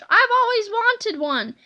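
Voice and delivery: high-pitched, eager delight; excited exclamation. A narrator's reading. Boy delights in his own pinball machine, exclaiming eagerly. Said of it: "exclaimed George".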